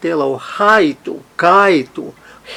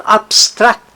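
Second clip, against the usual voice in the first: "abstract" slowly, with the weight on STRACT; stress on last syllable; 5 semitones higher than usual. Shown as a demonstration. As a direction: land firmly on stract in "abstract". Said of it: "ela é oxítona".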